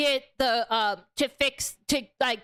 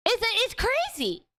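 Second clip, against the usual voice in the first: much higher, disbelieving exclaim; incredulous; 10 semitones higher than usual. Real, not acted. Streamer reacting to the movie's popularity, high incredulous exclaim. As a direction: let the disbelief push the pitch up.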